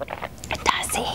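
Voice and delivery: a quick whisper; whispered "Does he?"; whispered aside. Real, not acted. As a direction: drop to a whisper for a sly question.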